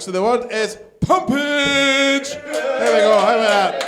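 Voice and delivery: usual speaking voice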